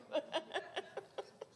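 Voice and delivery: high airy laugh through tears; tearful laugh. Real, not acted. Speaker thanking the representative, tearful laugh. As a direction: laugh helplessly while welling up. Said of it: "I got tears in my eyes".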